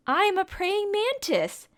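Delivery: spring the answer like a surprise; excited reveal. Pretend. Teacher revealing the answer to children, excited and high.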